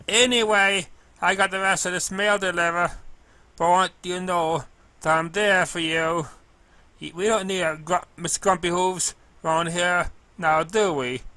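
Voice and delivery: a sweet clumsy mail pony, bright and keen; girlish cartoon pony voice, slowed and earnest; Derpy's voice.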